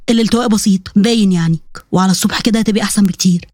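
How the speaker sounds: low, even male voice; husband examining injury. Acted. Husband assessing his bride's sprain, low and matter-of-fact. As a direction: give the verdict calmly, pitched well down.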